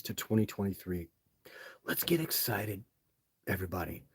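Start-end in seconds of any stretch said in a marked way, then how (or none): 1.8-4.0 a playful whisper telling everybody to get excited; mock hype whisper. Teasing hype aside to viewers.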